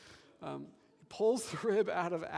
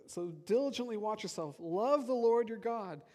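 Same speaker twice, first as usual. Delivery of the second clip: higher-pitched scripture command; emphatic exhortation; 4 semitones higher than usual. Acted. Preacher repeating the verse to show its emphasis, raised exhortation. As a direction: press the command home. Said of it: "the emphasis actually continues".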